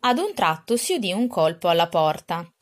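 ordinary delivery

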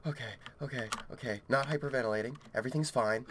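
low, very soft reassurance to self; steady yourself under your breath; hushed self-calming. Real, not acted. Player trying not to hyperventilate, hushed.

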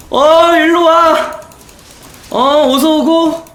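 cackling villain taunts, slow and high; mad scientist. Pretend. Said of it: "mad scientist laugh while chasing".

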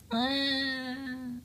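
sudden high-pitched cry of alarm; high scream. Acted. Character crying out in fright at an attack. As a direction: let out a shrill startled ah.

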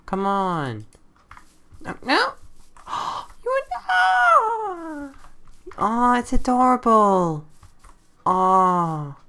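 high, gentle coaxing coo; cooing at a cat. Real, not acted. Player coaxes a jungle cat closer, high gentle coo. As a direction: coax the cat not to run.

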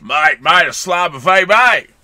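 loud, hammed-up Aussie call; Australian accent. Podcaster defends his accent to his co-host, loud broad Australian. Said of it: "that's my Australian accent".

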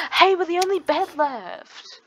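high, loud, drawn-out announcement; excited. Real, not acted. Player telling teammate about the last bed, excited. Excited discovery during game.

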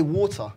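"water" as "waw-tuh"; British pronunciation. Shown as a demonstration. Answering how they usually say it, British "water". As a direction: say "water" with a long aw, no final r. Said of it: "usually we would say".

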